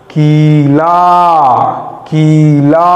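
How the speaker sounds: Kali said very slowly, long आ and ई; teacher modelling the word slowly; slow drawn-out reading. Shown as a demonstration. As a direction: stretch Kali out syllable by syllable. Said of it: "इसको पढ़िए".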